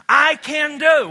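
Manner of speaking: "I can do" shouted high and slow; loud slow declaration. Shown as a demonstration. Preacher leading the congregation, loud and slow. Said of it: "One more time".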